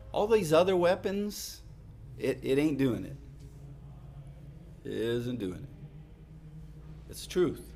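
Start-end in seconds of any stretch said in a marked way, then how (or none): 4.8-7.1 1 slow quiet correction. Real, not acted.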